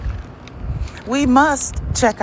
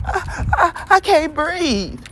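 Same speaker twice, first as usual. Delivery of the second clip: higher, whiny mock of the complaint; mocking whiny quote; 8 semitones higher than usual. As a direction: parody someone stammering helplessly. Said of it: "Check your code that says".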